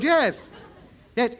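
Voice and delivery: normal talking voice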